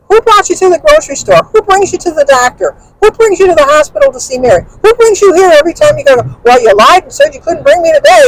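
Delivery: high-pitched indignant rhetorical questions; worked-up retelling of a row; retold angry tirade. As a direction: re-enact firing accusing questions at him. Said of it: "I was so mad at him".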